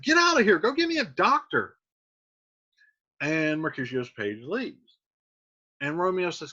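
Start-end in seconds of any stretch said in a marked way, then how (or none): 0.0-1.8 high loud order to fetch a doctor; urgent order. Retold or imitated. Wounded Mercutio orders his page away, urgent and loud. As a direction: bark at the page to run for help. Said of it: "He's like".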